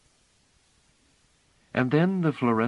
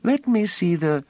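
much higher, louder breathless plea; gasping eagerness; 13 semitones higher, 6 dB louder than usual. A narrator's reading. Child begging the flute player to see, breathless and eager. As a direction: blurt it out, too eager to finish. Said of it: "she cried out gaspingly".

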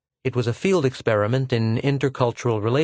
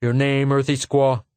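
lightly harsh, contemptuous; harsh officer; 4 semitones higher, 3 dB louder than usual. Acted. Lieutenant addressing Pola, mild harsh sneer. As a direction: insult her with the question. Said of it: "said harshly".

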